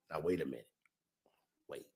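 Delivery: whispered low, as if catching himself; hushed, conspiratorial pause; whispered aside. Real, not acted. To the listeners, a sudden whispered realisation.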